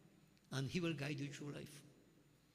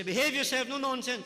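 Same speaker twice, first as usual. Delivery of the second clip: loud, high, stern telling-off; stern scolding; 8 semitones higher, 9 dB louder than usual. Retold or imitated. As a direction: bark it like a strict guru. Guru scolding the joking devotees.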